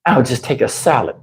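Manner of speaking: low, quiet sulky mutter; sulky girlfriend. Retold or imitated. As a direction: sulk it out softly. Girlfriend sulking, voiced low.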